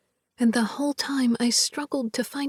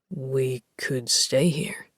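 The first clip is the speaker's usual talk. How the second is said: slow, forcing each word out; slow, forced; 9 semitones lower, slower than usual. A narrator's reading. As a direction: drag the words out slowly. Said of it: "slowly, like he's forcing the words out".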